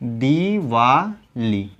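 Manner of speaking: दीवाली very slowly as दी, वा, ली; syllable by syllable. Shown as a demonstration. Teacher spelling it out for students, slow दीवाली. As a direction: hold every syllable of दीवाली. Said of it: "ये क्या हो जाएगा".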